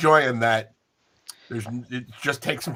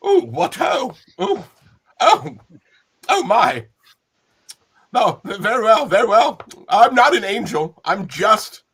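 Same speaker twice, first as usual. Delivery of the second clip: prim nanny voice; 5 semitones higher, 3 dB louder than usual. Acted. GM voices Mary Poppins flirting.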